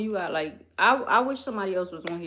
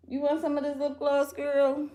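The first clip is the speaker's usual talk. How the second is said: pet voice; 7 semitones higher than usual. Real, not acted. Teasing the cat again.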